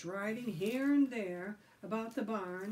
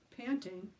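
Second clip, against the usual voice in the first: usual speaking voice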